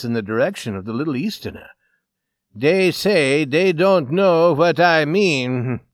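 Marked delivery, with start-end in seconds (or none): 2.5-5.8 slow, loud, raised complaint; bitter. Acted. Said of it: "he remarked bitterly".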